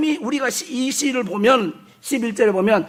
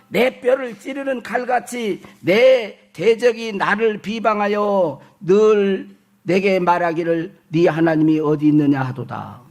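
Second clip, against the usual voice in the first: slow, drawn-out scripture reading; 4 semitones lower, slower than usual. Acted. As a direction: read the verse deliberately, sinking in pitch at the close. (느리고 길게 끄는 성경 봉독조)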